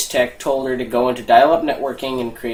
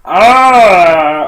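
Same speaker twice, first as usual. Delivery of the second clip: long, loud aah of realization; loud drawn-out "aah"; 2 semitones higher, 8 dB louder than usual. Acted. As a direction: let out a big dawning "ah". Puppet customer finally understands.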